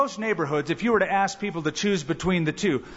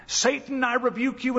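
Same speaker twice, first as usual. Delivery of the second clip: mock rebuke; 7 semitones higher than usual. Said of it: "I've heard people say".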